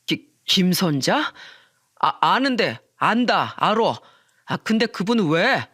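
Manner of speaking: hesitant stammer from a thrown man; flustered. Acted. Friend asked about the new manager, flustered stammer. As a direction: stumble as the name sinks in.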